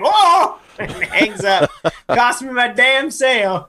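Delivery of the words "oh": startled oh. Retold or imitated. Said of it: "the guy's like".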